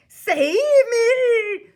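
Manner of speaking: very high, loud, drawn-out shriek; screamed. A narrator's reading. Giant in a nightmare about Jack, screaming. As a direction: scream for rescue at full pitch. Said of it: "screamed the flesh lump eater".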